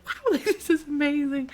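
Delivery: high-pitched gushing with a laugh; self-mocking high wonder; acted awe. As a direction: marvel up high, giggling. Acting her awe holding pot.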